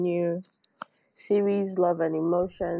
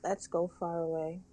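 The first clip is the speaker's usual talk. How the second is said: soft intimate poem reading over music; whisper in left ear; 4 dB quieter than usual. Acted. Poem read softly over music.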